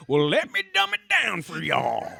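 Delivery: high, loud, slow southern drawl; southern accent. Retold or imitated. Comic talking down to a baffled crowd, southern accent. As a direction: imitate a comic going broad southern. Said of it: "He went back into his southern accent".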